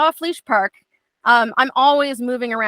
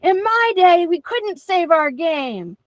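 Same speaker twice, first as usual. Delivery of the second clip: put-on old-timer lecture; 7 semitones higher, slower than usual. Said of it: "And I'm like".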